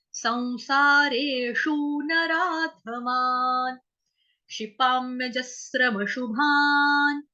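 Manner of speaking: slow, syllable by syllable Sanskrit chant; slow teaching recitation of the verse. Shown as a demonstration. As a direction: break each word apart, slowly. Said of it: "इसको तोड़ तोड़ के पढ़ेंगे".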